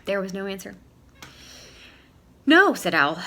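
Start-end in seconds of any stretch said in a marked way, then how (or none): 2.4-2.7 loud high no; exclaiming. Acted. Owl answering himself, loud high no. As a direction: blurt it out loud. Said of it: "Owl answering his own call".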